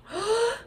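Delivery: quick high gasp; excited gasp. Real, not acted. Viewer seeing her get the headpiece, gasping. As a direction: gasp at a sudden reveal.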